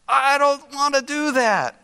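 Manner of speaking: high-pitched, acted balking; mock reluctance. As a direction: balk like it is a huge chore. Voices a sinner balking at confessing.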